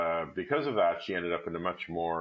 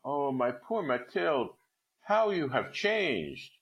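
slow, higher shocked exclamation; shocked cry; 8 semitones higher, slower than usual. Acted. Old friend seeing Mathilde worn down, slow shocked cry. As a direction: gasp it out, stunned by her change. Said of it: "Her friend uttered a cry".

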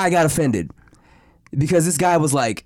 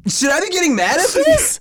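high-pitched bewildered question; confused, half-mad; 11 semitones higher, 3 dB louder than usual. Retold or imitated. Asking himself over the duck remark, hammed-up confusion. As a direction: imitate exasperated disbelief. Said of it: "I was confused".

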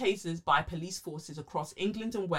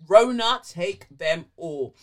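drawn-out mock curse; 10 dB louder, slower than usual. Podcast host hams up a curse on the virus, loud and slow. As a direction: summon the virus like a hammed-up spell.

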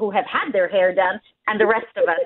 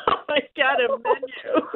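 mock emotional gasp; 4 semitones higher, 3 dB quieter than usual. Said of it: "I was like".

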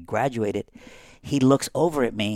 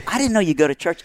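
higher, louder surprise; surprised retort; 8 semitones higher, 4 dB louder, faster than usual. Retold or imitated. Telling his buddy, higher and surprised. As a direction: sound caught off guard. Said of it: "I was like".

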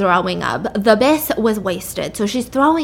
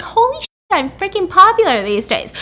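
higher-pitched, hammed-up surprise; mock amazement; 9 semitones higher, 3 dB louder than usual. Drunk woman gets another knock at her door, hammed-up amazement. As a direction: act out amazed delight, higher.

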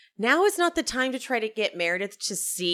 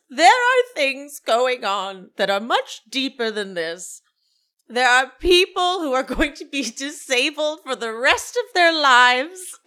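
hammed-up distressed diva, building louder; Meredith; 4 semitones higher, 6 dB louder than usual. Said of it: "Then she starts crying".

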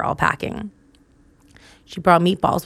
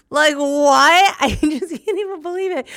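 laughing disbelief; 9 semitones higher than usual. Real, not acted. Telling viewers the movers packed the meatballs, in laughing disbelief. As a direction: throw up your hands and laugh it off.

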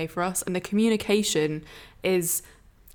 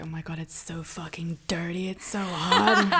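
whispered; 3 semitones lower than usual. Retold or imitated. Quoting partner during sex.

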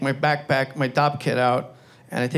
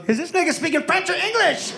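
much higher, panicky; panicked Anthony Anderson; 12 semitones higher than usual. Retold or imitated. Stoned actor panicking over the TV language, high panicky voice. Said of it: "has, like, a panic attack. He goes".

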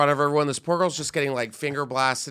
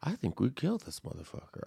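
quoting Destiny; 10 dB quieter, faster than usual. Retold or imitated. Retelling Destiny's line: quiet put-on deadpan. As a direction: say it softly, flat and cool. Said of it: "she was the one who's like".

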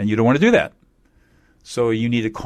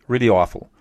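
usual speaking voice

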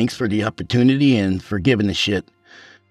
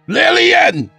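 very high, loud yell of LILLIAN; urgent yell; 6 dB louder than usual. Acted. Husband demands wife appear, very high urgent yell. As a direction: scream for her at the top of your range.

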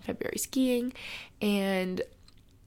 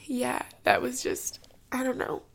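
tearful; 4 semitones higher than usual. Real, not acted. Daughter remembering her giving dad, real tearfulness. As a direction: hold back sobs, with long pauses to recover. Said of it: "I'm, like, sobbing".

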